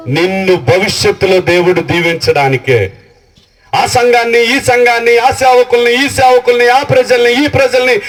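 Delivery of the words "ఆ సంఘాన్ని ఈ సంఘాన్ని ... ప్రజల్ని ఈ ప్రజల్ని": rhythmic preaching. Acted. Pastor declaring what the meetings are for, rhythmic preaching. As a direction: hammer each this-and-that in rhythm.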